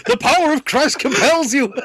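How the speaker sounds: high, loud exorcist line through laughter; hammed-up priest casting out the beast, laughing; laughing mock exorcism. Priest whacking Nessie with a basket, laughing mock exorcism.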